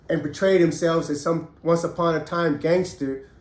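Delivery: normal talking voice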